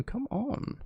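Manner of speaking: ordinary talk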